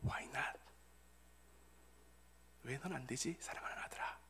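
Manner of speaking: low, hushed divine reply; God's gentle answer. Acted. God answering a bereaved son, tender hushed voice. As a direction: answer very softly, fading to a whisper. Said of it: "그때도 하나님께서는 응답해 주십니다".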